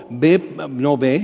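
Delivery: ordinary delivery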